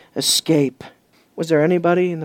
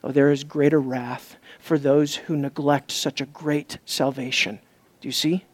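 hushed intensity; 7 semitones lower, 7 dB quieter than usual. Real, not acted. Preacher hushing the congregation, grave and quiet. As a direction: let it sink in, quietly.

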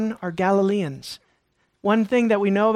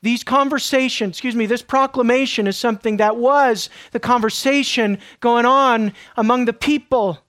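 ordinary talk

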